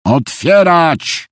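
high, drawn-out officer's bark; barked order. Acted. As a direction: bark the command, stretching it out. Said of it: "szczeknął oficer". (szczekliwy, przeciągły rozkaz)

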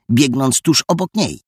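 ordinary delivery, nothing marked

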